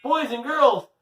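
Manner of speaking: high, loud cheerful host call; cheery sign-off. Real, not acted. Host saying goodbye to young listeners, bright high call.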